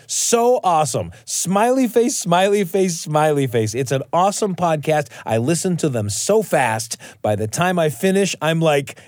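put-on high, eager child-like reading; kid reviewer voice. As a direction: act out the emoji with glee. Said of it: "Great job rendering those emoji".

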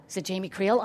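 plain reading voice

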